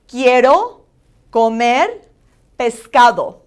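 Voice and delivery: loud "Quiero", "comer" both rising, "pescado" falling; accentuated Spanish vowels. Shown as a demonstration. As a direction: model "Quiero comer pescado" with a pause after each word. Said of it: "vowels are very important that you accentuate".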